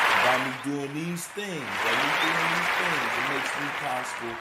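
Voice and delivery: loud, rising declamation; hyped-up radio preaching; emphatic shout. Real, not acted. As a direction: drive the point home loudly.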